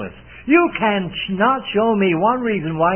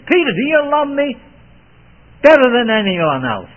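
the Lord asking; 7 semitones higher, 7 dB louder than usual. Acted. Lord's question voiced.

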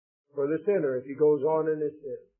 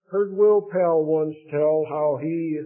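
ordinary delivery

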